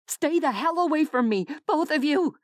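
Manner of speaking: woman warding off two strangers, shouting. A narrator's reading. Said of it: "Della shouted".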